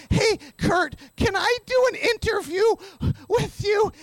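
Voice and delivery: high, hopeful asking; re-enacted keen, high-pitched plea; eager request. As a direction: ask your idol for a big favour. Retold nervous request to star.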